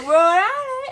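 hammed-up high shout of Rhode Island; high loud mock announcement. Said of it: "He's like".

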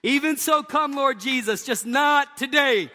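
high, loud, drawn-out mock plea; hammed-up prayer. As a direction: cry out to the Lord, then slow on the punchline. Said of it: "two things".

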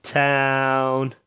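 usual speaking voice

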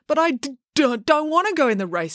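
high, stammered objection; stammering protest. A narrator's reading. Joe refusing April's order to race, stammering. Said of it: "You may stammer".

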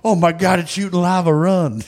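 high yelling in mock panic; panicked bro shout.

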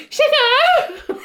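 mock squeal. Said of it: "he's just like".

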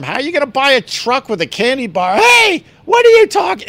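taunting high-pitched jab; rising mocking taunt. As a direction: needle the duped winner. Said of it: "tell him".

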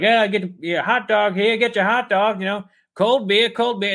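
high vendor hawking call; ballpark vendor. Retold or imitated. Imagining a ballpark, vendor hawking hot dogs. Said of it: "you're like".

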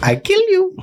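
put-on high, squeaked Austrian accent; Arnold. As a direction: push the Austrian threat up into a squeak.

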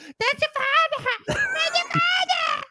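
put-on shrieking, crying, slurred drunk shout; getting drunker. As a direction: drunk and wailing, slowed way down. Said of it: "Your impression of John gets drunker and drunker".

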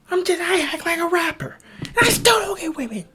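high, loud frustrated outburst; exasperated shout. Real, not acted. Ranting that he still doesn't get women. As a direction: yell it in disbelief.

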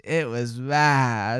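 "bad" held very long, pitch low; very slow, lingering verdict on "bad"; drawn-out emphasis. Real, not acted. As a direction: stretch "bad" right out. Third emphatic repeat of verdict.

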